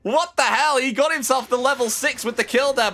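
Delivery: fast, high call building to a shriek, then easing; astonished play-by-play. Real, not acted. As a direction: erupt in real disbelief at the play. Commentator reacts to surprise kill.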